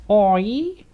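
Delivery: high, loud, hammed-up Kiwi accent; a cheery New Zealand greeting, badly faked Kiwi accent. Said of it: "the worst, uh, Kiwi accent, that I could ever do".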